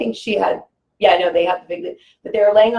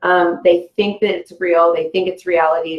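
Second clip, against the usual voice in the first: ordinary talk, nothing marked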